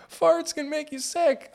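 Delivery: very high, slow, silly voice; joking with the guys. Pretend. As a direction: squeak it out slowly as a joke. Repeats the jokey king's line.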